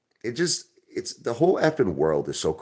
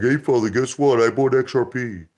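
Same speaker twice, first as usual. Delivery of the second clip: high, loud, mock-eager little investor; mocking small investor; 6 semitones higher, 4 dB louder than usual. Mocking retail investors bragging to their ape father, high and eager. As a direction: play a proud small-timer calling out to dad.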